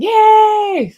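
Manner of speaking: high, loud burst of joy; excited cheer. Real, not acted. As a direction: cheer for the viewer. Cheering a viewer's comment.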